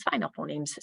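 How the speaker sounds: ordinary talk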